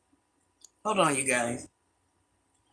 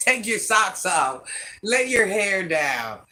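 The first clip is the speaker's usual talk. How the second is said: slow; 5 semitones higher, slower than usual. Real, not acted. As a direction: stretch each word to invite them to relax. Telling viewers to relax.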